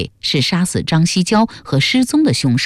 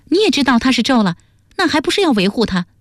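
high, quick character voice; sudden interjection; 8 semitones higher, faster than usual. A narrator's reading. Duan Sengnu challenging Ma for shielding the prince, sudden high interjection. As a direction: cut in fast, pitched well up. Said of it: "段僧奴忽然插口说".

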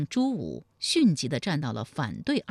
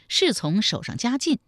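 ordinary delivery

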